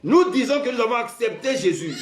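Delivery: high, loud preacher's call; preaching at full force. Real, not acted. Preacher rising to the church, full-force preaching. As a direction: lift the whole congregation with it.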